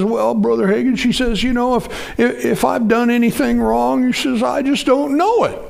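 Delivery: imitating a weepy woman; crying woman. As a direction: mimic her tearful protest. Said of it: "started crying again".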